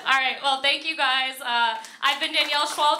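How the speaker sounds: usual speaking voice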